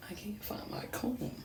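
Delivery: under the breath, to herself; whispering. Real, not acted. Quick aside while searching.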